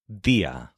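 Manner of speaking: "día" with a fully formed Spanish D; correct, normal D. Shown as a demonstration. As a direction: say "día" with the tongue firmly on the teeth. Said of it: "it sounded correct and normal".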